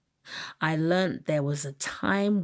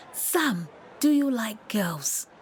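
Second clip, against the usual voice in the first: put-on slow, higher-pitched question in an African accent; 5 semitones higher, slower than usual. A narrator's reading. Kid teasing a classmate, in an African accent. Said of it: "asked in an African accent".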